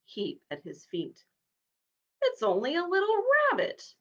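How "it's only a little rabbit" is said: amused. Acted. Horse mocks small rabbit.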